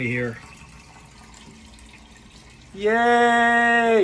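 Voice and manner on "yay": high loud yay; cheering. Real, not acted. Celebrating filling the carboy.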